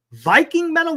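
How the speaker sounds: incredulous echo of the genre name; surprised question. Real, not acted. Reading a strange genre aloud, surprised high echo.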